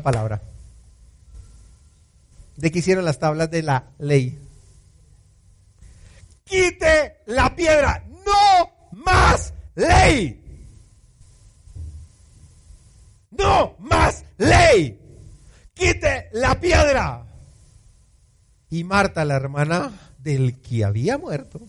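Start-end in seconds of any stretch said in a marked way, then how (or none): 6.4-17.2 high, slow, shouted order; shouted command. Acted. Jesus orders the stone away and ends the law, slow shouting. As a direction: cry out the command with full force.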